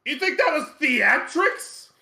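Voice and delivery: high, slow indignant whine; indignant imp. Acted. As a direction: protest like an offended imp. Chard protesting theatrics.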